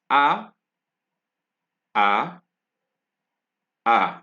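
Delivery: A as an open "ah"; French A sound. Shown as a demonstration. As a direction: say A as a drawn-out "ah". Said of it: "are pronounced A".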